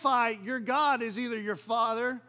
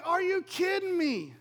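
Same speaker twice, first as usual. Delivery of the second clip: high, slow exasperated outburst; frustrated disbelief; 8 semitones higher, 4 dB louder than usual. Acted. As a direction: play an exasperated Paul, stretching the words. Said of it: "Now you see Paul's so frustrated".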